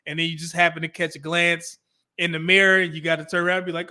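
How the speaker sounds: usual speaking voice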